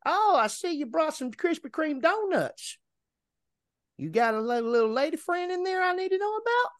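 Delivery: high, put-on prying voice; nosy neighbor voice. Neighbor quizzes driver about donuts, high prying imitation. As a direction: imitate the neighbor fishing for gossip. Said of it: "He goes".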